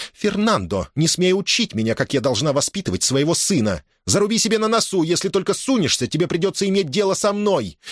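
high-pitched threatening reprimand; furious tirade. A narrator's reading. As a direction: rebuke and threaten until cut off mid-word. Mother's furious tirade, broken off. (гневная отповедь, высоким голосом)

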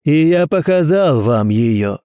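slow, high, fervent preaching; thundering sermon. Acted. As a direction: preach slowly with fanatic fervour. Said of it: "в гремящую проповедь".

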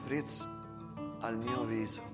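ordinary talk

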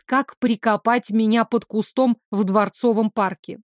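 ordinary delivery

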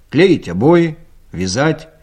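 usual speaking voice

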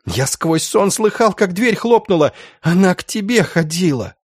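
high-pitched, anguished accusation; hateful cry. A narrator's reading. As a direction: point out the killer in a strained outcry. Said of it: "выкрикнул с ненавистью".

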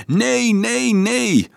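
high, ringing crowd cry; enthusiastic roar. A narrator's reading. Children answer Dolf's question all together, enthusiastic roar. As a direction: chant it like a fired-up crowd. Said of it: "brulden ze geestdriftig".

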